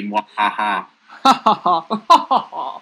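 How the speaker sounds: hammed-up cartoon villain cackle; evil laugh. Mock villain gloat after taunt.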